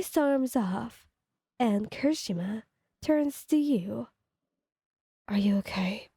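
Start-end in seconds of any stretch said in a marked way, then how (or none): 5.3-6.1 gentle whisper. Acted. Kirishima checking on his upset friend, whispering. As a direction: ask it gently under your breath.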